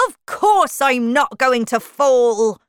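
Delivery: loud, slow, indignant character voice; angry. A narrator's reading. Humpty Dumpty angrily rejecting Alice's worry, loud and slow. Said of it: "said Humpty Dumpty angrily".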